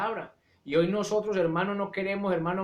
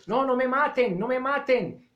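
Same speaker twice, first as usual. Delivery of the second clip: pleading cry; 7 semitones higher, 3 dB louder than usual. Pretend. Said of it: "ni estaba gritando".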